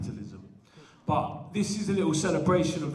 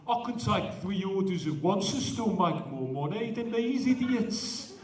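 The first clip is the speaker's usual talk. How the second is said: a lightly put-on Brummie accent; Birmingham accent; 3 semitones higher than usual. Poet voicing a Birmingham chip-shop worker, light Brummie accent. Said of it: "Sorry for the accent".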